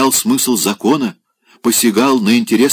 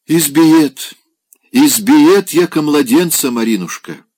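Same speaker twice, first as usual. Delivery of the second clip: высоко и громко, с притворным хныканьем; жалуется, как плаксивый пьяница; хныкающий пьяный; 8 semitones higher, 6 dB louder than usual. Acted. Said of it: "начал хныкать и всхлипывать". (пьяный Опёнкин хнычет Марине о жене)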